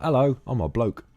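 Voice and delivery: a hint of a British accent on bloke; mock British. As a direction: toss off a jokey British greeting. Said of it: "I said the word bloke".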